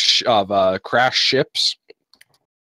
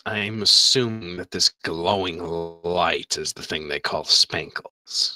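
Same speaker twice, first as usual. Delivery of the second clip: priestess voice; 6 semitones lower, 6 dB quieter than usual. Acted. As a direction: speak as a sinister fish priestess.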